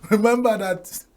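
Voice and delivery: ordinary talk, nothing marked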